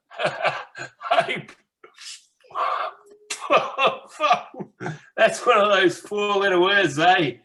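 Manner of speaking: high, mocking laugh through the words; derisive, high-pitched cackling scoff; scornful laughter. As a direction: laugh it off as a ridiculous idea. Scoffs at the idea of hope.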